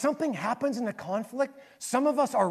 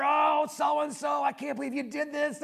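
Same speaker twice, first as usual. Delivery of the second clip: mock angry rant; 8 semitones higher than usual. Lincoln venting in an unsent letter, mock outrage. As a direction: act out fuming over a letter. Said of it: "he'd get so mad".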